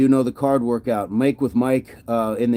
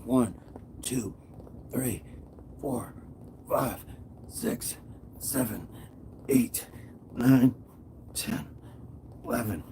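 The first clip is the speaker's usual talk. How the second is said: breathless counting under effort, much softer; strained counting; 11 dB quieter, slower than usual. Real, not acted. Streamer counting his push-ups live for chat, strained and soft. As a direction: squeeze each number out mid push-up.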